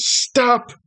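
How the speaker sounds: annoyed little brother. Acted. Game master voicing the shopkeeper's brother, high and whiny. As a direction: threaten to tell Dad like a bratty sibling.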